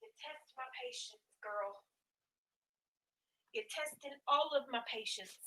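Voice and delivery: calling to pets from far across the room; high, distant voice away from the mic. Real, not acted. Asking the kittens what they want, far off and exasperated.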